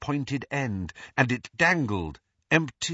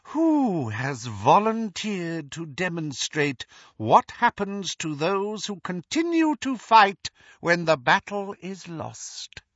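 slightly raised cold taunt; a hint of cold villain; 7 semitones higher than usual. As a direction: taunt the fallen challenger. Voldemort mocks the challenger.

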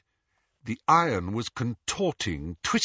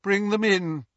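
cold woman's voice; 10 semitones higher than usual. A narrator's reading. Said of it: "she said".

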